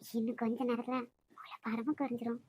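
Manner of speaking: near-whisper, secretive; hushed. Acted. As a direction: keep it down so no one overhears. Hushed talk in the room.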